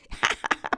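short acted cackle; knowing, wicked chuckle; crow's cackle. A narrator's reading. Crow laughs at a cruel riddle, cackling.

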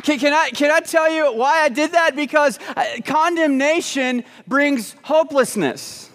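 high, urgent preacher delivery; impassioned preaching. Real, not acted. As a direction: press the point home, slowing at the end. Preaching at full force.

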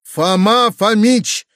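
loud, slow, high announcement; announcing. A narrator's reading. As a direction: proclaim the arrival, stretching the name. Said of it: "возвестил Видоплясов, входя в комнату". (торжественное объявление)

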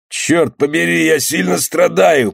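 high, drawn-out complaint; wounded skipper groaning his words; pained moan. A narrator's reading. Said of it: "простонал шкипер Зели".

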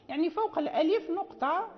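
ordinary talk, nothing marked

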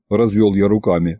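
plain reading voice

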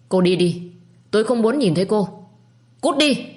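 rising, building to a high cry; angry dismissal. A narrator's reading. Lâm ordering his wife out, rising anguished anger. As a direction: drive her out, climbing to a pained shout. Husband drives her away.

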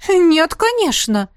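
high, loud, slow indignant protest. A narrator's reading. The dragoness indignantly rejecting the heroine's fear. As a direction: protest the idea hotly. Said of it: "возмутилась драконица".

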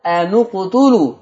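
slow أن اقتلوا with the nun rounded to "u"; stressing the damma. Shown as a demonstration. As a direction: lean on the "u" of أن اقتلوا. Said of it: "أنا بأكد عليها أهو". (تأكيد ضم النون في أن اقتلوا ببطء)

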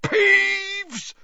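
very high, loud triumphant yell; gleeful roar. A narrator's reading. Filch catching Peeves, loud gleeful roar. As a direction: roar the name with glee. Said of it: "Filch roared gleefully".

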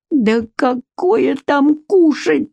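crying out, high and very slow; tearful cry. A narrator's reading. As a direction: wail it near tears. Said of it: "плачущим голосом закричал хозяин".

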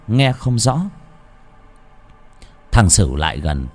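plain reading voice

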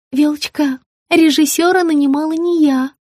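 high plaintive lament; plaintive wail. A narrator's reading. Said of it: "жалобно запричитала Маргарита".

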